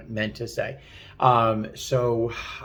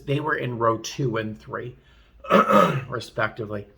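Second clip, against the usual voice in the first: ordinary delivery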